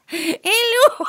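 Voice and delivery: put-on high, hammy South African accent; a badly faked South African hello. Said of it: "I can't do a South Af".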